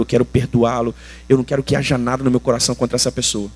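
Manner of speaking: low, earnest praying voice; retold prayer. Pastor re-enacting his prayer to forgive someone, earnest prayer. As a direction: pray it low and sincerely. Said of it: "várias vezes essa oração".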